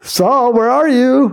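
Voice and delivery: high, calling out for someone hiding. Pretend. Preacher calling for hidden Saul, high and sing-song. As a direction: call out like searching for a lost kid.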